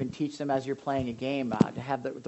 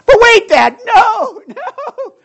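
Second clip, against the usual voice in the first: put-on much higher and louder, laughing through a whiny child's protest; pleading child; 21 dB louder, much slower than usual. Son begging his father not to spank him, squealing. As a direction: play the kid begging Dad.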